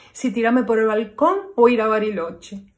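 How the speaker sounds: loud melodramatic outburst; mock despair. Heartbroken woman's loud melodramatic outburst. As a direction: wail it like a heartbroken diva.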